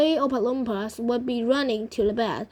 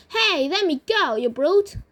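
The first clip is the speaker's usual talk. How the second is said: higher, louder indignant storybook cry; acted outcry, pitched up at the start; crying out in protest; 6 semitones higher, 5 dB louder than usual. A narrator's reading. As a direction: call out in protest as a grandma. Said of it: "cried Grandma Georgina".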